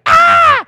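loud high scream of fleeing demons; mock demon scream; demon shriek. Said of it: "demons in that area were like".

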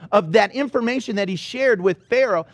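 normal talking voice

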